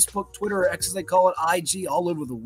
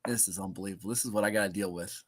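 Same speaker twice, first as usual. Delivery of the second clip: muttering; 9 semitones lower, 11 dB quieter than usual. Real, not acted. Exasperated aside under breath.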